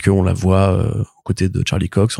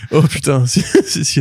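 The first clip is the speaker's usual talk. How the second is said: laughing through the words; high giggling fit; hysterical laugh; 7 semitones higher, slower than usual. Real, not acted. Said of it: "laughs at the teasing".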